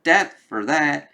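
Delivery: mildly hammed-up pissed threat; pissed-off villain. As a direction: act out an irritated villain's threat. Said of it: "now he's pissed".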